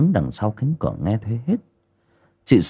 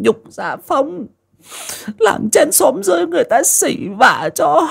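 put-on much higher, mild sobbing old woman's lament; weeping old mother. Old mother laments to her dead husband's portrait, mildly tearful. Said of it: "thắp lên một tuần nhang mà nức nở".

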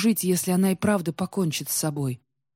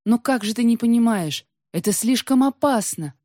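loud, higher, urgent warning; shouted protest; 6 semitones higher, 5 dB louder than usual. A narrator's reading. Wolf warns the queen of danger, shouted protest. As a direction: cry out a desperate warning. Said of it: "закричал волк".

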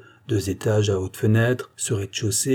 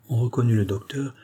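ordinary delivery, nothing marked

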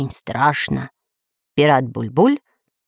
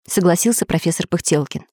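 usual speaking voice

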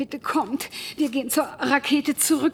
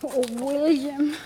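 ordinary delivery

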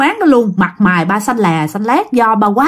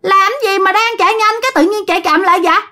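high-pitched, piercing ghostly woman's voice; shrill ghost woman; 11 semitones higher than usual. Acted. Ghost woman urging dad to ride faster, shrill. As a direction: screech it eerily, high and urgent. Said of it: "cái giọng của bà đó the thé".

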